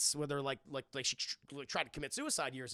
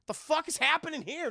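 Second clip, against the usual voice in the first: put-on high, loud baby voice, bewildered; confused baby voice; 10 semitones higher, 6 dB louder than usual. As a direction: voice the baby asking what's going on.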